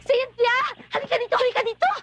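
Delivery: high, excited calls across the room; calling out. Acted. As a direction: call them over, thrilled. Calling friends over excitedly. (masayang pagtawag nang malakas)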